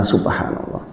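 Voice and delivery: breathy whisper of grief; whispered subhanallah. Retold or imitated. Grieving father whispering in sorrow. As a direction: whisper it in shock.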